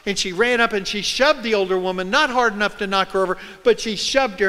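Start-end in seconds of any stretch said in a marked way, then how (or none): none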